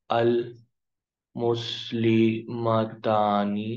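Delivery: المسلمتانی stretched very slowly; slow teaching pronunciation; very slow model word. Shown as a demonstration. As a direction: draw out every syllable of المسلمتانی. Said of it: "یہاں پہ کہیں گے".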